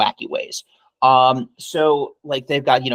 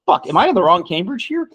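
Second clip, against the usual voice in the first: higher-pitched, baffled question to yourself; retold bewilderment; 7 semitones higher than usual. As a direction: replay your confused double take. Retelling own baffled reaction at station.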